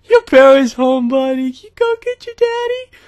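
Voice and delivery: put-on high, slow, patronizing talk to a child; imitating the kid. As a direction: talk down to him like he's a little boy. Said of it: "he's like".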